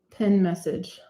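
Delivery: ordinary talk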